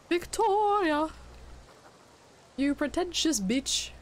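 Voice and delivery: high mocking shout; yelling at screen. Real, not acted. Yelling at game character.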